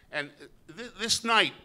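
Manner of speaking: ordinary delivery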